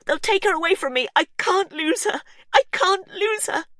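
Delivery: desperate, near hysterical; frantic Verity. A narrator's reading. Verity begging Hugh not to expose her, frantic.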